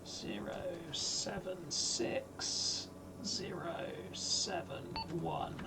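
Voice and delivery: whispered slowly to himself; a slow whisper, barely any voice. Real, not acted. Repeats grid under breath.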